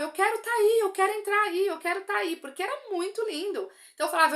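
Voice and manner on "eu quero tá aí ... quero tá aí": higher, louder mock yell of longing; pleading call toward heaven, raised and high; retold yelling. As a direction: cry out that you want to be there, half re-enacted. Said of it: "Eu gritava, eu gritava".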